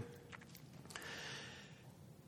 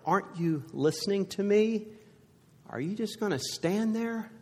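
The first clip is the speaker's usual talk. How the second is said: lifted, pleading question in higher pitch; pleading to God; 6 semitones higher than usual. Retold or imitated. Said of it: "feel like saying to God".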